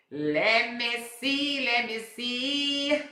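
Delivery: stretched slow and high; slow musing. Real, not acted. Checking the clock for viewers, slow and drawn out. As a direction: muse aloud while checking the time. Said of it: "checking time, musing".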